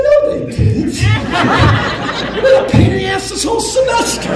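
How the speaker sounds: high, laughing mock denial; laughing tease. Teacher teasing his old student, laughing. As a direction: tease her through a laugh.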